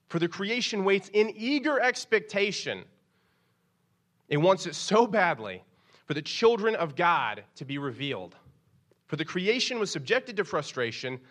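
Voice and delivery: loud, high, preached scripture; scripture reading. Acted. Pastor reading Romans aloud to the congregation, preaching. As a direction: proclaim the verse to the church.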